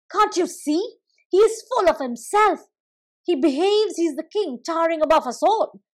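jealous hills shouting; envious angry hills. Acted. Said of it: "said the hills".

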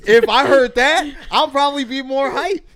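high and loud, laughing through it; laughing excitedly. Real, not acted. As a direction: laugh out the hype. Laughing, hyped about music.

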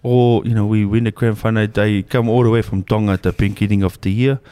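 thick put-on Tongan accent; comic Pacific Islander English; fob accent. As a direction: play a fresh-off-the-boat Islander. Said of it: "the Islander accents".

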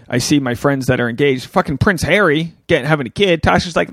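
ordinary talk, nothing marked

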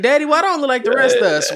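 a little son asking innocently; kid voice. Retold or imitated. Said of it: "They gonna be like".

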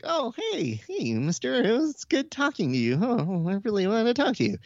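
high, lightly voiced Keith, gushing; eager Keith. Imitating Keith greeting the interviewer, high and gushing. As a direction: play Keith as overeager and friendly. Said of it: "And Keith is like".

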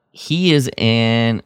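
ordinary talk

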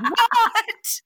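high, loud, laughing "What?"; shocked. Retold or imitated. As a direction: squeal of disbelief. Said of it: "and I was like".

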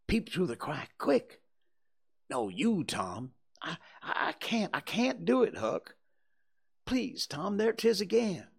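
high, urgent frightened pleading; frightened boys. Acted. Two boys hear a dog howl, frightened. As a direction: voice two scared boys, pitched well up.

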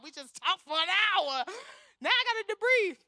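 hammed-up weary protest; mock exasperation. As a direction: whine it as if worn out by talking. Acts her dread at debriefing after counseling.

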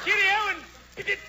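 high British cheerio; hammed-up English farewell; English Santa Claus. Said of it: "English Santa Claus".

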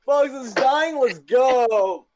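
high gleeful shouting; excited cheering. Real, not acted. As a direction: cheer wildly, delighted. Cheering friend in pain.

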